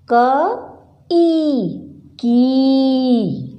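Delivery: "क", then a long "ई", then joined into a drawn-out "की"; teacher blending "क" and "ई" into "की", each sound held and clear; sounding out a syllable. Shown as a demonstration. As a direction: build "की" slowly from "क" plus "ई" for children. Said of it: "उच्चारित करके बताऊंगी".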